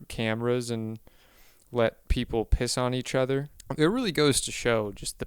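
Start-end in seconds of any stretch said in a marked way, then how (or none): none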